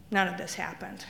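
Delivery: ordinary talk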